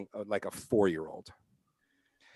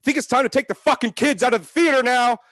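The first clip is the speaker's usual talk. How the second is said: much higher, louder retold yell; 12 semitones higher, 11 dB louder than usual. Friend yelling at parents in cinema, loud shout. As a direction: shout it across the dark theater. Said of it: "my friend literally yells out".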